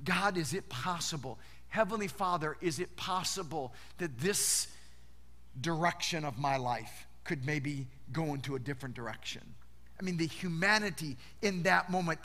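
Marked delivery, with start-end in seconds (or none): 0.0-9.4 soft, low, pleading prayer; a quiet plea to the Father; hushed prayer. Retold or imitated. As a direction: lower and softer anguished prayer. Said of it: "Jesus knelt on a rock".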